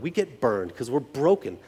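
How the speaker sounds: ordinary talk, nothing marked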